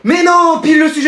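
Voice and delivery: high loud despairing no; panicked outburst; dismayed cry. Acted. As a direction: wail at the exam paper. Sees the exam subject.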